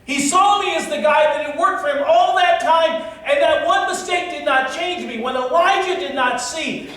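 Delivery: high, loud, ringing pulpit voice; full-force preaching. Acted. Pastor rousing the congregation, preaching at full force.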